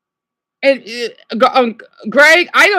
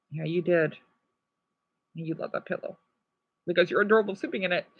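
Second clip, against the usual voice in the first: much softer, fond and doting; soft affectionate murmur; tender; 5 semitones lower, 11 dB quieter, faster than usual. Real, not acted. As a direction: coo fondly at a loved one. Fondly addressing Dion.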